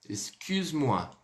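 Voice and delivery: "Excuse-moi" said slowly, lips rounded on the u as /y/; slow pouted excuse. Shown as a demonstration. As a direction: stretch out "Excuse-moi" with a pout on the u. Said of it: "fazendo biquinho aqui no excuse". ("Excuse-moi" devagar, com biquinho no u)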